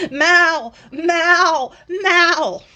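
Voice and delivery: high, loud, drawn-out name call; mocking repeated cry. Reviewer mocking the audiobook's constant name-calling, exaggerated cry. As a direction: wail the name like an overdramatic character. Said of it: "every time that they said Mal".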